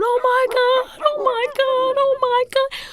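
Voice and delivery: both screaming in shock at the news on TV; panicked screaming. Retold or imitated. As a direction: relive the terror of the moment in a high wail. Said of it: "I start screaming".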